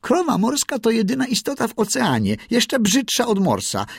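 very high, screeching seagull voice; high-pitched squawking jeer; shrieking gull. A narrator's reading. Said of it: "mewa krasnolotka i wrzasnęła".

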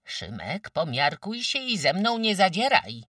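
starts level, climbs to a loud high scold; threatening warning. A narrator's reading. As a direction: warn him, building in force. Mother threatens her son. (groźnie, coraz głośniej)

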